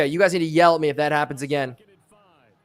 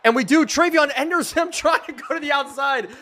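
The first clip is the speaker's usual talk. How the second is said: high-pitched excited commentary; excited play call; 8 semitones higher than usual. Real, not acted. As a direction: call the breakaway run, voice jumping up. Live call of a running play.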